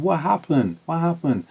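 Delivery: high-pitched, worried questioning; alarmed mum. Retold or imitated. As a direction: imitate a mother startled by her child's tears. Mum alarmed at crying child.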